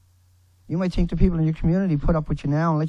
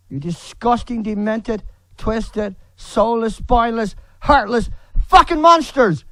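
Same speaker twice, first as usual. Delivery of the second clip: each insult louder, climbing to a higher yell; stacked insults growing into a shout; rising furious tirade; 5 semitones higher, 5 dB louder, slower than usual. Real, not acted. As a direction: build from seething to a roaring insult. Escalating insult list at critics.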